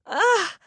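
high, loud yelp as she's shoved; a startled pained cry; shoved aside, she yelps in pain; sharp cry of pain. A narrator's reading. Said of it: "I cried".

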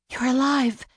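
high-pitched burst of relief; relieved exclamation. A narrator's reading. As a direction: exclaim it on discovering he's alive. Discovers injured man is breathing.

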